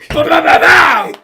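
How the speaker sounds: high, loud shouted pow sounds; punch noises. Retold or imitated. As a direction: shout the punches like a cartoon fight. Acting out punches.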